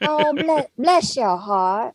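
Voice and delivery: slow, thick Southern drawl; very drawn-out Southern demo; thick Southern accent. Shown as a demonstration. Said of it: "really lay it on thick".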